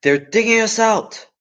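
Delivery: high, loud cry to wake the family; alarmed shout. Acted. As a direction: yell them awake in alarm. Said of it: "he shouted".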